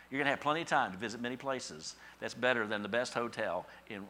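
ordinary talk

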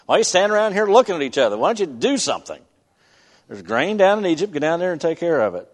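put-on fast, high, exasperated old father; biblical patriarch. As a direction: scold them like a fed-up old father. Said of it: "the old patriarch of the family".

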